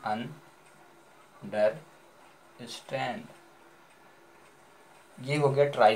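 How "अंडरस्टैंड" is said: Understand very slow, softer, long gaps between अन, डर, stand; broken into syllables. Shown as a demonstration. Teacher showing what the broken word becomes, understand in three spaced pieces. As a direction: pause fully after अन and after डर in understand. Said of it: "टूट के क्या बना".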